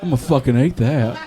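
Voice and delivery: lightly acted low, soft resolve; stupid younger self. Dim kid deciding to eat the candy, low soft aside. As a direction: drop low and soft, as if to yourself.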